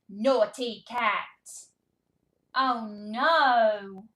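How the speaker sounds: loud, slow, exaggerated scolding; mock dismay. Shown as a demonstration. Reading to toddler, loud mock dismay. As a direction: ham up the shock.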